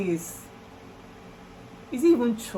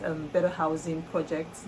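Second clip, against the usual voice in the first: normal talking voice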